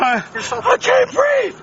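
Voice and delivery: slow, high, panicked screams for help; desperate screaming. Real, not acted. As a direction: scream it out, begging for air. Pinned, shouting for help.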